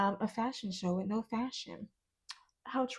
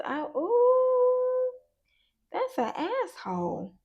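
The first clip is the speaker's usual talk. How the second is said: high, loud shocked yelp; 5 dB louder, much slower than usual. Real, not acted. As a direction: gasp at the card. Shocked reaction to a card.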